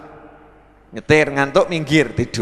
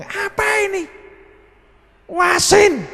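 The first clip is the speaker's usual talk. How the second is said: complaining husband; 13 semitones higher, much slower than usual. Retold or imitated. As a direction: shout at the wife over the food. Husband complaining about food. (suami protes sambil berteriak)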